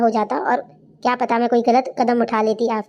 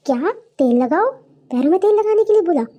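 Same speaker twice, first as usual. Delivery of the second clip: incredulous questions, jumping higher on the second; shocked disbelief; 5 semitones higher than usual. Acted. Friend hearing she must oil her in-laws' feet, shocked disbelief. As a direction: echo it back in rising disbelief.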